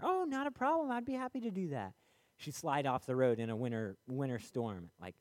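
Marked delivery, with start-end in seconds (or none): 0.0-1.9 very high, bright put-on helper voice; cheerful stranger. Stranger agreeing to bury grandma's cat, very high and cheerful. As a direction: chirp it out like an eager helper. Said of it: "Somebody come along".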